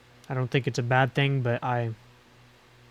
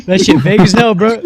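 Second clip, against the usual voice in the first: put-on country accent; 7 semitones higher, 16 dB louder than usual. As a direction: belt it out in a thick country accent. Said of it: "that was my morning voice".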